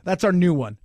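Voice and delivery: usual speaking voice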